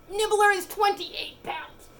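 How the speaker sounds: high, slow cooing voice; pet talk. Real, not acted. Showing her cat to viewers in baby talk. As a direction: coo it out to the cat.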